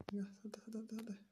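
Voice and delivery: urgent whisper. Real, not acted. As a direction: urge them out in a hushed rush. Whispering urging the target out. (susurrando con urgencia)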